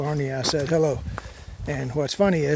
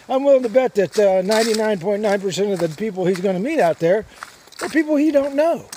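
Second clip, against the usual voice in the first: retold retort; 7 semitones higher, 8 dB louder than usual. Retorting to the dog owner.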